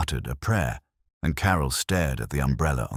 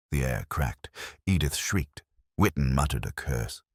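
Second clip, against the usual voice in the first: ordinary delivery, nothing marked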